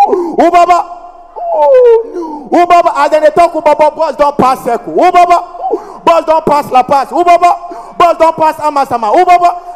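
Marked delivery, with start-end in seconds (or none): 0.1-2.9 high, stuck syllables with long straining gaps; helplessly blocked on the first sound; mock stammer. Passenger trying to call his stop, blocked high stammer. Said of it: "He started". 3.5-9.7 high, stuck syllable bursting out over and over; hammed-up panicky blocking; mock stammer. Passenger stuck as the bus passes stops, high mock stammer. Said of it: "He started".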